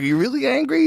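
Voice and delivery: imitating Bobby. Comic imitating Bobby asking if he's angry, high teasing squeak. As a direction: squeak it like teasing someone's anger. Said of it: "he's like".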